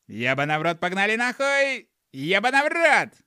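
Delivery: loud, high incantation, building to the last words; gnome casting spell. A narrator's reading. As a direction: chant the curse like a spell, rising to a shout. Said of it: "пробормотал гном".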